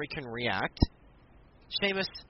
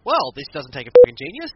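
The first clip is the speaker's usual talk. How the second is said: mock referee; 15 dB louder than usual. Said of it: "So he goes".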